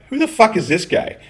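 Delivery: high-pitched mock disbelief; incredulous outburst. Viewer reacting to a professional Scotsman, mock incredulous. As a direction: jump up high, baffled by the stranger.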